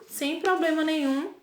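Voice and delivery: normal talking voice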